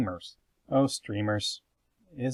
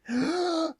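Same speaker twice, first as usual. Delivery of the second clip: sharp high gasp for breath; comic gasp for air. Acted. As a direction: gulp in air after the long list. Running out of breath after list.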